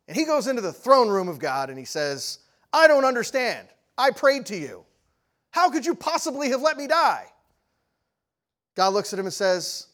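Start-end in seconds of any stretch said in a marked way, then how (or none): 2.7-7.3 loud, high indignant protest; acted angry outburst, pitched high; furious. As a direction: demand an explanation from God. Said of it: "he's angry. He's furious".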